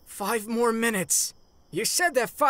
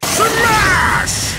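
battle cry; 11 semitones higher, 12 dB louder than usual. Acted. All Might's battle cry.

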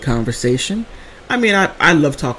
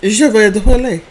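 a touch louder, higher French line; mock French; 6 semitones higher, 4 dB louder than usual. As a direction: speak French like the nurse. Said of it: "she's like".

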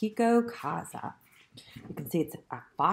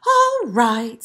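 louder, higher storybook sigh; 4 semitones higher, 8 dB louder than usual. A narrator's reading. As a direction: give in with a big sigh. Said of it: "sighed the piglet".

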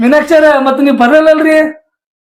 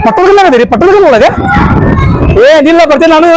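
higher-pitched call across a distance; 5 semitones higher than usual. Acted. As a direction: call after them to wait. Calling out to others far away.

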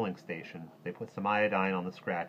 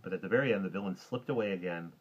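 ordinary delivery, nothing marked

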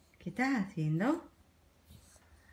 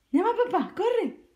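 put-on much higher, bright baby-talk urging; high sing-song coaxing; 11 semitones higher, 4 dB louder than usual. Shown as a demonstration. Mother coaxing her baby to call dad, high sing-song. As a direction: pitch way up to coax the baby into saying it.

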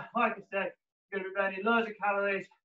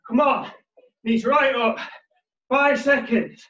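gym bro; 5 semitones higher, 9 dB louder, slower than usual. Acted. Fitness coach calling workout cues.

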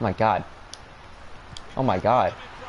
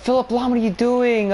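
much higher, louder, fed-up cry at the screen; exasperated yell; 14 semitones higher, 5 dB louder than usual. Real, not acted. Gamer yelling at a player's blunder, exasperated and much higher.

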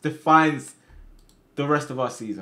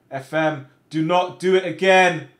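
firm, raised-voice order, higher at the end; loud warning; 3 semitones higher, 10 dB louder than usual. Real, not acted. Player orders the game not to score against him, loudly. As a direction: warn it loudly, word by word. Pleading with game in last minute.

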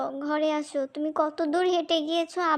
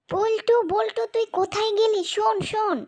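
loud, high urgent call; 6 semitones higher, 3 dB louder than usual. Acted. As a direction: call out in alarm. Girl calls brother urgently.

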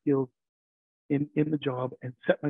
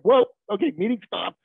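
startled whoa; 6 semitones higher, 6 dB louder than usual. Retold or imitated. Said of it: "And I was just like".

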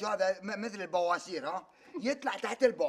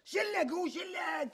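shout; 6 semitones higher, slower than usual. Acted. Son shouting at father.

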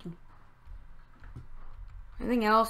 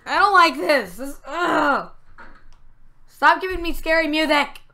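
high, raised-voice complaint; alarmed protest; 5 semitones higher, 4 dB louder than usual. Real, not acted. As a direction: protest loudly at being spooked. Protesting at scary game music.

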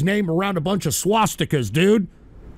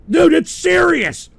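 exasperated shout; 6 semitones higher, slower than usual. Real, not acted. Answering listener, exasperated.

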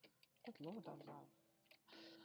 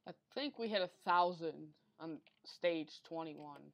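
normal talking voice

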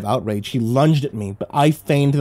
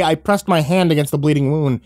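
ordinary delivery, nothing marked

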